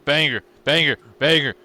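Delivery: loud excited chant of banger. Retold or imitated. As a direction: hype it up, punch each banger. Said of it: "I'm like".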